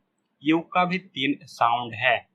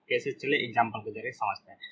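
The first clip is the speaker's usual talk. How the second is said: usual speaking voice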